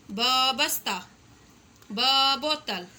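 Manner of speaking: ब punched loud, then बस्ता and बोतल; stress on ब. Shown as a demonstration. Teacher drilling the ब sound for children, loud. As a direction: hit the ब hard before each word. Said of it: "ब की आवाज़".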